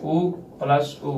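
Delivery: ऊ प्लस ऊ with each ऊ held long and slow; drawn-out vowel demo. Shown as a demonstration. Teacher shows students how ऊ plus ऊ makes a long vowel, slow drawn-out ऊ. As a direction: stretch out each ऊ slowly, like a teacher sounding vowels. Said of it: "फिर ऊ भी इसी तरह से है".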